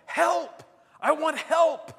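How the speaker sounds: loud, very high desperate cry; full-voiced acted plea for rescue; crying out for help. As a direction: shout for help as if trapped. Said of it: "And he cries out".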